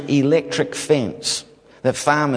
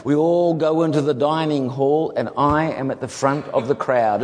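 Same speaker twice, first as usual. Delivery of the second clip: ordinary delivery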